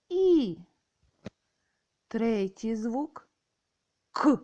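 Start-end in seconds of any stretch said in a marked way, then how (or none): 0.1-0.6 І sung out high and loud; drilled vowel. Shown as a demonstration. Teacher modelling the vowel І, high and loud. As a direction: sound out І for the children. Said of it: "Другий звук".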